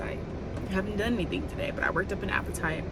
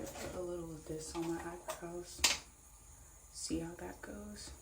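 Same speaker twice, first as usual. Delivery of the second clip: whisper; 13 dB quieter than usual. Real, not acted.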